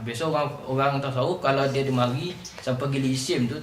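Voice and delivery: ordinary talk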